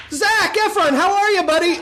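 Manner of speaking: high, bright buddy-buddy greeting; mock friendly greeting. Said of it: "Like that".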